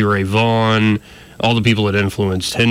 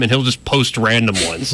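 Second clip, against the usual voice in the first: usual speaking voice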